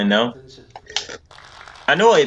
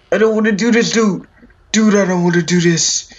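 scared whine; 6 semitones higher, 3 dB louder than usual. Real, not acted. Scared gamer still whining.

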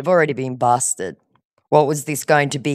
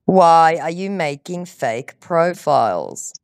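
slow, deliberate repeated question; slow, insistent; slower than usual. Real, not acted. Woman pressing a dodging scammer, slow and emphatic. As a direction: spell the question out slowly, word by word.

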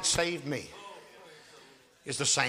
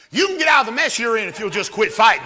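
raised, louder preaching voice; forceful exhortation; 6 semitones higher, 9 dB louder than usual. Real, not acted. Preacher urges listeners out of their mess, forceful exhortation. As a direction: urge the congregation with force.

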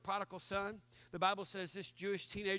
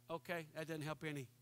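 deadpan aside; 5 semitones lower, 9 dB quieter than usual. Retold or imitated. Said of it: "You're like".

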